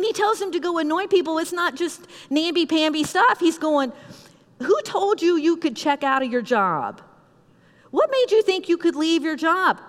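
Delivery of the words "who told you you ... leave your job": indignant, raised-pitch telling-off; acted stern rebuke; mean scolding. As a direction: lay down the law, demanding and stern. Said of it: "say it as mean as I'm saying it".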